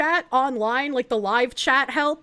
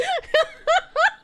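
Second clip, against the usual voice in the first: squealing laugh, much higher; giggling at her own joke; high burst of laughter; 14 semitones higher than usual. Real, not acted.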